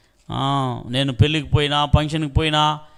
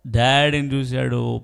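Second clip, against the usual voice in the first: ordinary talk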